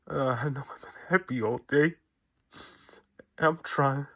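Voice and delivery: high, strained voice breaking into tears; crying while speaking. Real, not acted. Fan mourning his hero, crying through words. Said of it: "I'm sorry for crying".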